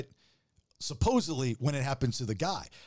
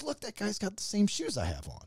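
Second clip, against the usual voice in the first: voicing his wife; 6 semitones higher, 4 dB quieter than usual. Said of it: "and she goes".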